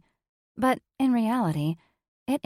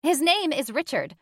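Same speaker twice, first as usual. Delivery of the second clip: snapped; 7 semitones higher than usual. A narrator's reading. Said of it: "Polly snapped at me".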